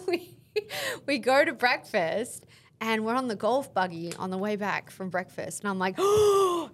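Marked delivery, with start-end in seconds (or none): 6.0-6.7 sudden gasp of realisation. Retold or imitated. Realises it's time, gasps.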